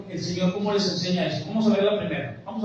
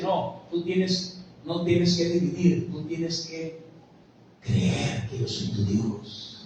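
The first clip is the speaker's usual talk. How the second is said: solemn hushed voice of God; 4 semitones lower, 6 dB quieter, much slower than usual. Acted.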